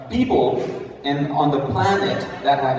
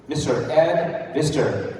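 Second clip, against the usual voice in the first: ordinary delivery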